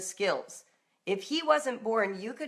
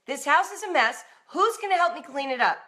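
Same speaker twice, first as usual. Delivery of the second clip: a louder, higher exasperated demand; exasperated parent, much higher and louder, fast at the end; wrong way to ask; 7 semitones higher, 6 dB louder than usual. Shown as a demonstration. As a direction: nag the family about the mess. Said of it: "You don't say".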